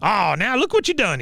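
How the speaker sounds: high-pitched mock scolding; Cotton. Veteran blaming Peggy for crying baby, imitated high scolding. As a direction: imitate an old veteran tutting in blame. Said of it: "He goes".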